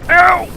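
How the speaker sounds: high loud yelp of pain; sharp yelp. Real, not acted. Player yelps when hit by poison, high and loud.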